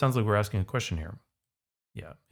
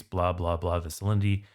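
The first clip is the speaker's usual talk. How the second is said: normal talking voice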